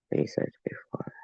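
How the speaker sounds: voiceless trailing whisper. Real, not acted. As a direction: drop to a whisper. Trailing aside, breathy.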